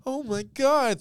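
high gasping mock shock; dramatic gasp. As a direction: gasp it like a diva. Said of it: "putting on the performance of her life".

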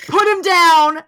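loud, high disgusted put-down; disgusted dismissal. Retold or imitated. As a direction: recoil in disgust. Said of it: "I'd still be like".

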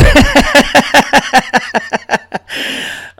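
high, loud cackling laugh trailing off; long laugh. Real, not acted. Comic laughing at his stupid animals, long cackle. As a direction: crack up at your own rant.

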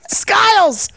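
high, punchy name shout. Shown as a demonstration. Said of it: "Just shouting Skiles".

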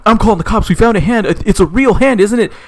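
high, acted alarm; freaking out. Dude who just found a hand wants the cops, freaking out. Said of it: "they're freaking out".

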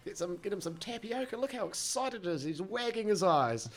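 high-pitched, mock-delighted carer; patronising carer. Carer offering tapioca to a twitching patient, mock-excited.

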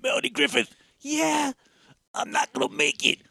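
high, hammy movie voice; mock dying cop. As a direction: parody a cheesy death scene. Parodying the death scene.

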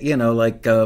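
ordinary delivery

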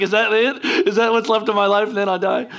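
hammed-up alarmed questioning; mock dismay. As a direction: voice a kid's sudden disbelief, played for laughs. Voices kid's alarmed thought.

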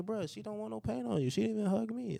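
high-pitched, exasperated retold plea; retold protest. Friend re-enacts warning off a paint-covered party jumper, indignant protest. Retelling own protest to a man.

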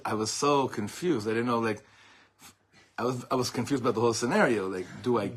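usual speaking voice

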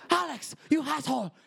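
very high yell, "Alex" as "Halex"; imitating grandma's H accent. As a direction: add an H before "Alex" and "asshole", yelled high. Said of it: "she says everything with an H".

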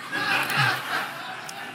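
unvoiced wheezing laugh; breathy laugh. Real, not acted. Preacher laughing at his bad-breath joke, unvoiced laugh. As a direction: let out a silent, airy laugh.

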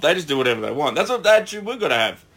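usual speaking voice

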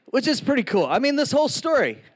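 usual speaking voice

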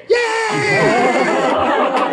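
put-on a high, whooping kids' cheer; mock cheering kids.

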